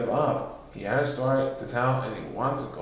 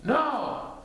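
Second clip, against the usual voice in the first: assuring; 13 semitones higher than usual. Acted. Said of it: "assured him in one voice".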